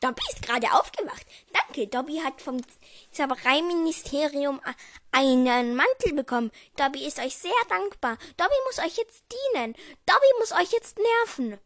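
improvised mock house-elf; Dobby. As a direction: play a thankful little elf, pitch climbing. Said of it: "vielleicht liegt da so ein kleiner Dobby".

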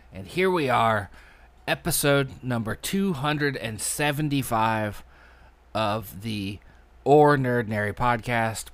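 big, loud opening announcement with drawn-out hello; podcast host intro. Real, not acted. Host greeting listeners to open the weekly podcast, announcer-style intro. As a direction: punch out the show's welcome like an announcer.

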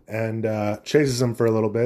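ordinary talk, nothing marked

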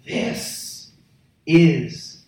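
slow, spaced words, dropping low; stretched-out, weighty lion's statement; roaring lion's declaration. Acted. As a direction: claim it word by word, with weight. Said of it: "reasons why a lion roars".

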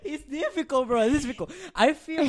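real laughing complaint, pitched high. Real, not acted. Friend struggling to speak English, laughing as they complain.

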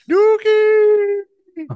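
very high, loud gleeful yell; shouted. Real, not acted. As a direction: yell the word Nuki out. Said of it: "We're on Nuki".

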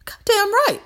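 high, loud, emphatic cry; triumphant shout. Real, not acted. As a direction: shout it out in pride. Proud exclamation to her viewers.

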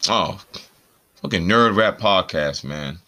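usual speaking voice

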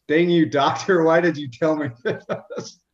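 half-laughing mock rebuke at the doctor; mock angry laughing.

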